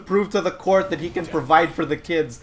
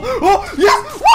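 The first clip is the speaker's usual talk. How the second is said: very high, loud cheer into laughter; wild winning whoop; jubilant; 7 dB louder than usual. Real, not acted. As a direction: scream with delight and laugh. Lands the deflect.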